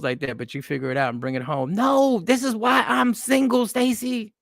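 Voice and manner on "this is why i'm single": ordinary talk, nothing marked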